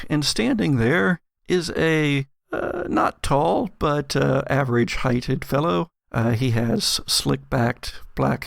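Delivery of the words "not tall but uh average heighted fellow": usual speaking voice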